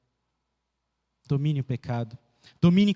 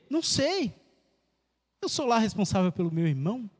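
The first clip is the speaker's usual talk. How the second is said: high scoffing "Não sei", then dismissive shrug of a reply; petulant retort; 4 semitones higher than usual. Retold or imitated. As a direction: toss it off like a defiant son brushing off his father. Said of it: "eu leio com essa entonação".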